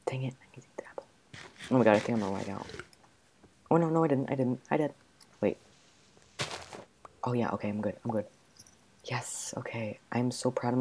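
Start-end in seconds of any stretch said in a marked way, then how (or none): 0.0-1.0 quick unvoiced frustrated whisper; fast whisper. Real, not acted. Hungry player whispering at herself, fast. 9.0-10.0 soft unvoiced whisper. Real, not acted. Relieved player whispering to herself.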